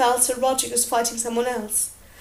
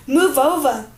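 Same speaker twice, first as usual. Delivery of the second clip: louder, barked; shove-over bark; gruff order; 7 dB louder than usual. A narrator's reading. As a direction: push him aside with the voice. Tyrion shoving the singer aside.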